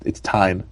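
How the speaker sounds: "Tyne" with a long i; "Tyne" as the corrected ending; rhymes with fine. Acted. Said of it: "Rhymes with fine".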